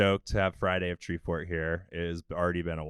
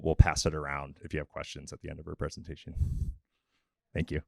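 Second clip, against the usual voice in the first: usual speaking voice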